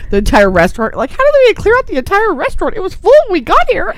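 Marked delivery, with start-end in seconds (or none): 1.1-3.9 much higher, puzzled diner voice; lightly voiced baffled onlooker; high mock bewildered voice. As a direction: squeak it like a confused restaurant guest. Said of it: "People are like".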